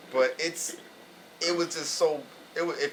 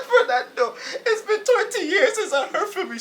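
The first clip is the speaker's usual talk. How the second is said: put-on high, loud tearful old lady through laughter; crying old lady; 8 dB louder than usual. Grandma to the grandson she thinks called, high tearful cry. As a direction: sob it out as an overjoyed grandmother. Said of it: "She started crying".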